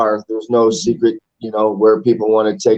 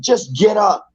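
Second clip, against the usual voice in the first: higher-pitched, mild mock yell; mock drunk-fan yell; 8 semitones higher, slower than usual. Drunk guy yelling from the fourteenth section, mild mock scream. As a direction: imitate a heckler without really shouting. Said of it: "the fourteenth section screaming".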